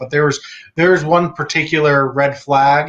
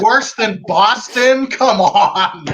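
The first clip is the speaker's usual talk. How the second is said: incredulous scoff; 6 semitones higher, much slower than usual. Retold or imitated. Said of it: "And I'm like".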